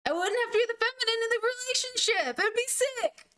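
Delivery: high, giddy and eager; excited. Real, not acted. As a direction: gush with excitement. Excited about marrying a femboy.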